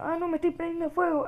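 high-pitched gamer panic; alarmed. Pretend. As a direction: cry out as if caught in flames. Character catches fire in game.

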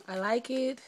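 ordinary talk, nothing marked

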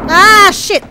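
loud high panicked cry; panicked yelp. Real, not acted. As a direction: yell as you nearly fall. Near miss in game.

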